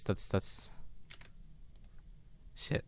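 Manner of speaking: breathy under-the-breath swear; whispering frustration after a mistake; whispered curse. Real, not acted. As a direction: hiss the swear to yourself. Muttered curse on wrong guess.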